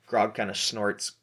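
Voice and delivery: ordinary talk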